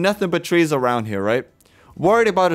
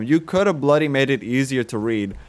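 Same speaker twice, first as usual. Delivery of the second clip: ordinary talk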